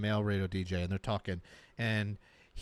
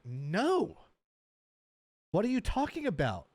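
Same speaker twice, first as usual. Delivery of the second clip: acted incredulous reply, rising high at the end; mock disbelief; 6 semitones higher than usual. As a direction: imitate a baffled radio host. Retold radio host's disbelief.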